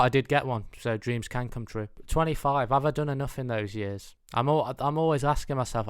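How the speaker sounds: ordinary talk, nothing marked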